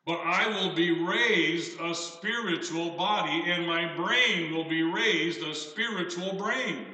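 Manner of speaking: slow, weighty Bible reading; scripture reading. Acted. Preacher reading scripture to the congregation, slow and solemn. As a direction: read the verse slowly, like a pulpit reading.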